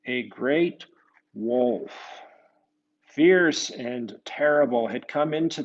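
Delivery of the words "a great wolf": usual speaking voice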